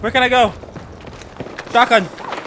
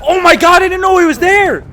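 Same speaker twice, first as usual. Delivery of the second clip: startled shout; 10 semitones higher, 5 dB louder than usual. Real, not acted. Startled by hidden enemy.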